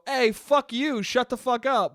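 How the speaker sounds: acted indignant comeback; mock angry retort. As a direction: imitate firing back at a nosy stranger. Acts out urge to snap back.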